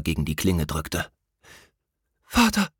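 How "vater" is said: much higher, a sudden relieved call; startled cry. A narrator's reading. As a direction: gasp out for your father. Prince cries out to father. (überraschter Ausruf)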